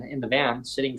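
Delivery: normal talking voice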